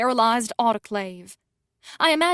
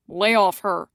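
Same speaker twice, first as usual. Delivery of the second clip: snapping; 2 semitones lower, 4 dB louder than usual. A narrator's reading. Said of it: "Sebastian snaps".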